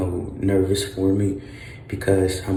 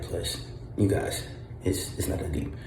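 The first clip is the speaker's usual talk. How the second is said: whispering; 3 semitones lower, 9 dB quieter than usual. Real, not acted. Quiet remark in the hospital.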